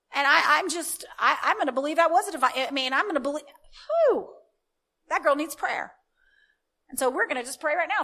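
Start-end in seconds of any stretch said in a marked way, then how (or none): none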